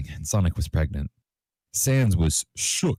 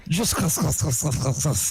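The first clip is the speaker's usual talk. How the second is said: screeching; 11 semitones higher than usual. A narrator's reading. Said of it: "Sonic screeched and started to cry".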